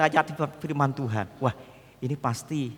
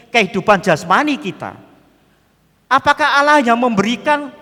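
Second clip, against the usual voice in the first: louder, raised preaching voice, driving each question home; preacher urges the congregation in a rising, louder sermon voice; fervent preaching; 7 semitones higher, 8 dB louder than usual. Acted. Impassioned sermon voice.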